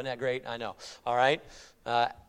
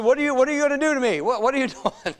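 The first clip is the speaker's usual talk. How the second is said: high, rapid mock protest; panicky demon; 8 semitones higher, 3 dB louder, much faster than usual. Preacher paraphrases demon pleading with Christ, mock panic. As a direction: blurt it out as a rattled demon. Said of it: "that demon knew who he was".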